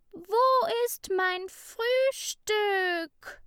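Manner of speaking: high, loud, slow furious shriek; angry scream. A narrator's reading. Ida yells across the classroom about her lunch, furious. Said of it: "schrie sie wütend".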